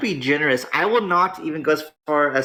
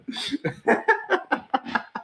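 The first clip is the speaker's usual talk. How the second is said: a high airy wheezing laugh; breathy laugh; 4 dB quieter than usual. Real, not acted. Streamer cracking up at a rival's collapse, wheezing laugh.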